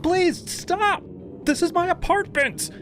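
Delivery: very high, desperate pleading scream; pained scream. A narrator's reading. Tenant being beaten begs her attacker, desperate scream. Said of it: "I screamed".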